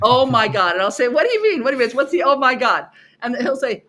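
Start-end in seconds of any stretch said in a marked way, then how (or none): 0.0-0.7 amazed exclamation. Retold or imitated. Students after the exercise, stunned and loud. 1.1-2.9 higher, louder mock-puzzled questions. Teacher to students after the exercise, mock puzzled and loud.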